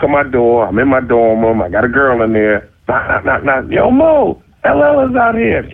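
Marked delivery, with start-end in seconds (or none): 3.7-5.7 retold high-pitched holler; calling out. Friends at the dorm door announcing a visitor, calling out. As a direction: call a friend through a closed door.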